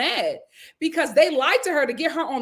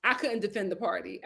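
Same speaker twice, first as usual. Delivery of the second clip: ordinary delivery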